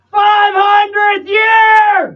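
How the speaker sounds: high, drawn-out full-force shout; band-name shout. Pretend. Band opens its radio station promo, loud drawn-out shout. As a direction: belt it out like a promo intro.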